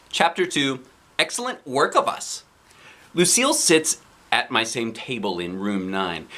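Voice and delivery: usual speaking voice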